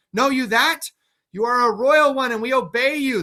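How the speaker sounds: a cornered king talking fast to his captors; frantic mad king. Acted.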